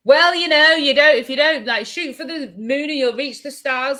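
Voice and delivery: loud, high mocking platitude; mock cheerleader. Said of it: "and not somebody say".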